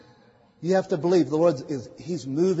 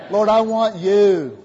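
fervent prayer; 3 semitones higher, 7 dB louder, slower than usual. Acted. Preacher voicing a prayer.

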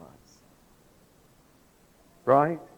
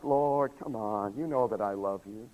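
soft, low protest; mildly bothered; 4 semitones lower, 7 dB quieter than usual. Retold or imitated. Peter answering the Lord's repeated question, softly bothered. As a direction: protest quietly, a touch put out. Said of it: "Then he got bothered".

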